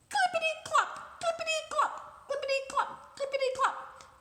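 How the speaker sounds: very high, bouncy clippity-clop steps. Acted. The princess hurrying after the prince, shoes clopping. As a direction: prance it out high like tiny heels.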